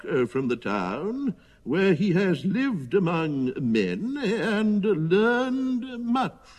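high, very slow, quavering voice; slow, high quaver with doddering hesitations; quavering old elephant. A narrator's reading. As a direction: draw it out haltingly, like a wavering elder. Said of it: "said in his quavering voice".

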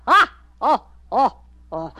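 loud scornful laugh; mocking laughter. Circus man laughing loudly at Jigsaw's failed dance. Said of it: "The circus man laughed and laughed".

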